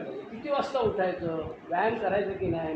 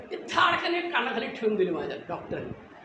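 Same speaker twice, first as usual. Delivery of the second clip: ordinary talk, nothing marked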